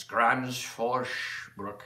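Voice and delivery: Grand Forsbruck drawn out slowly in a rural Swedish dialect. Shown as a demonstration. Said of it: "You have to do this short".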